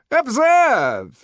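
high, loud, falling exclamation; triumphant. Acted. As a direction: proclaim it in triumph as the director. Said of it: "said the director triumphantly".

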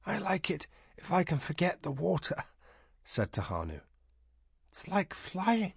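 soft wistful. A narrator's reading. Tahanu's soft wistful reply.

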